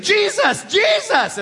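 high, loud, thrilled "Jesus, Jesus!"; excited shout. Retold or imitated. Stranger shouts with excitement at a Jesus picture. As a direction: shout the name like a fan. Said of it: "he got very excited about Jesus".

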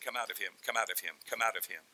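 low, hushed repeated command; soft, deep, insistent order; quiet command. Acted. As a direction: murmur it low, over and over. Jesus commanding demons, repeated.

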